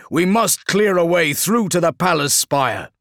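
high, loud rallying command; rally the troops over battle; shouted orders. Acted.

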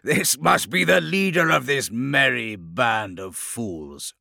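mock them as a gloating horror; monstrous bubbling horror. A narrator's reading. Chaos lord sneering at the band of fools, monstrous mockery. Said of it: "His voice was a deep, bubbling horror".

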